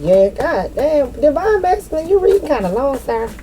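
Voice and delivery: high, teasing mock voice of the spirits. Spirits ribbing the reader for reading too long, high teasing. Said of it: "They said".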